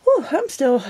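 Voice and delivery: usual speaking voice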